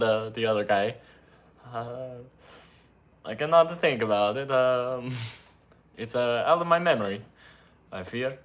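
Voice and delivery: confused cartoon Italian musing; Italian accent. Editor [name] denies knowing Luigi, Italian accent. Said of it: "My name is [name]".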